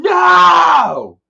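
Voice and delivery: high, loud, drawn-out wail; imitated outcry. Recalling Dad at the unveiling, imitated long loud outcry. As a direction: let one word ring out long and high, falling at the end. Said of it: "I remember Dad fucking being".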